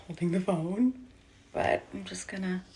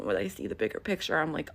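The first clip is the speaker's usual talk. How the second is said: ordinary delivery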